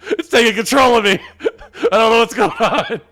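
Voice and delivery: real hysterical laughter, high and loud; squealing, can't get a grip; losing control laughing. Real, not acted. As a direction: shriek words out through helpless laughter.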